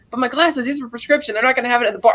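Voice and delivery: high-pitched retold complaint; worried protest. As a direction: lightly act out a fretful objection. Acted complaint about broken glasses.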